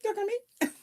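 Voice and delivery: high, soft, bashful; a mock blushing squeak; flattered coy voice. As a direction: act shy and flattered. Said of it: "you're like".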